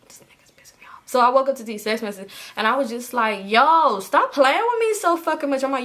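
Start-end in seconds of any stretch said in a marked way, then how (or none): 0.1-1.0 quick whispered grumble after a sigh; exasperated whisper. Retold or imitated. Acts muttered exasperation to herself. 3.5-5.6 high, loud retold scolding; telling him off.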